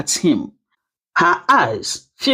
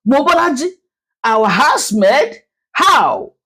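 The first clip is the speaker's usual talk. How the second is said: shocked gasp; 6 semitones higher than usual. Acted. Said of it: "Gasps flew".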